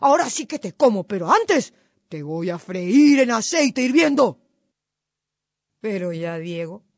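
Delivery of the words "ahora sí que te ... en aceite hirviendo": fooled fox vowing revenge; angry shouting. A narrator's reading. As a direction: yell in outrage, stretching the key words. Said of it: "se puso a gritar".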